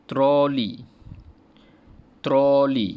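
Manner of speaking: "Troli" loud, falling; review repetition. Shown as a demonstration. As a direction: ulang "Troli" dengan kuat. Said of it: "Cikgu ulang balik".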